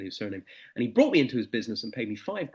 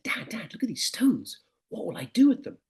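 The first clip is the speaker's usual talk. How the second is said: put-on higher, eager teenage boy; higher voice of an excited lad calling his dad; excited young son; 9 semitones higher than usual. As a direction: play the sixteen-year-old showing off his find.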